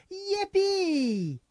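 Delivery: put-on high, childlike cheer; Annie. Host quoting little Annie in the movie, high kid's cheer. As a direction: squeal it high like an excited little kid. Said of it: "jumps up and says".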